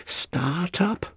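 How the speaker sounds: high, restrained coaxing; urging. A narrator's reading. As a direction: coax the engine to start. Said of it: "he urged".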